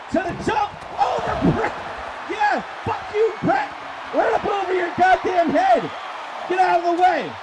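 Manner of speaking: high, loud gloating outburst; excited play-by-play. Real, not acted. Commentator cheering a dive over Brett, rowdy and excited. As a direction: yell it as if jeering from ringside.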